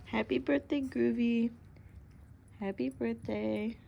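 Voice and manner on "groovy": normal talking voice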